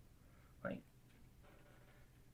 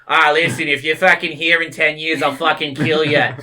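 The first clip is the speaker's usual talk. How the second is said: loud, rough mock Boston accent; hammed-up Boston tough guy, louder; Boston accent badly faked, raised voice; terrible Boston accent; 3 semitones higher, 8 dB louder than usual. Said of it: "That's a terrible accent".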